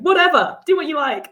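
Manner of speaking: high, loud careless shrug of a voice; dismissive casting director. Retold or imitated. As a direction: toss it off like you don't care. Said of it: "by kind of going".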